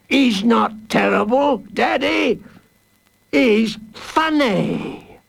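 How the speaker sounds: put-on high, slow little child; child's voice. Warden imitating the child in the crowd, high. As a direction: mimic a giggly child.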